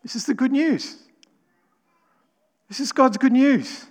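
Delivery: excited. Real, not acted. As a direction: proclaim the good news with delight. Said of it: "before we get too excited".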